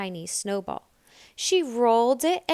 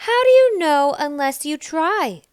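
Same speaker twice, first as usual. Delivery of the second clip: higher, louder eager cub; Bear Cub; 7 semitones higher, 10 dB louder than usual. A narrator's reading. Bear Cub coaxes Nell to try. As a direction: challenge her kindly. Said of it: "asked Bear Cub".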